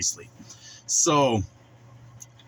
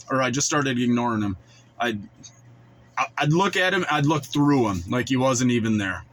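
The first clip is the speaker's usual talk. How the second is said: ordinary delivery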